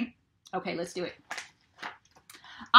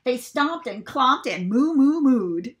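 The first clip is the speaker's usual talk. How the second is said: louder, mooing with gusto; noisy cows; 3 semitones higher, 7 dB louder, slower than usual. A narrator's reading. Narrator acting out excited stomping mooing cows. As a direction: stomp and moo it out. Reading animal noises.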